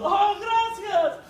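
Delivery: a high, slow, lightly voiced tearful woman; mild imitation of crying relief; tearful thanks. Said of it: "Ellas están llorando". (mujeres agradecidas entre lágrimas, voz alta y lenta)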